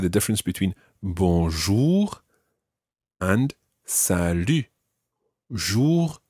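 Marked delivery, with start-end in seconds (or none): 5.5-6.2 jour with a round "oo" vowel; back OO vowel. Shown as a demonstration. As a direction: hold the "oo" in "Jour" to show it. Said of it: "It's a completely different vowel sound".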